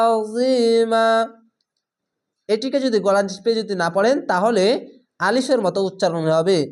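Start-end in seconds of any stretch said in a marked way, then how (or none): 0.1-1.1 slow drawn-out tajweed chanting; Quran recitation. Shown as a demonstration. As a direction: recite it slowly, stretching the vowels. Recited word.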